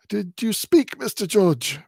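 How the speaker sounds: put-on a wary old man checking; slightly high old-man voice; old man Smallweed. A narrator's reading. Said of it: "inquires the old man".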